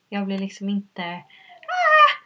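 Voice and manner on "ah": sudden high scream, cut short; mock scream. To the listener, showing the scream she held back. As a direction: let out one sharp high cry. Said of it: "jag skriker inte".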